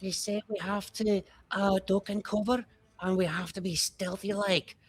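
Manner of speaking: put-on a little boy relaying the plan to his friends; lightly voiced orc kid. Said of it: "he's like".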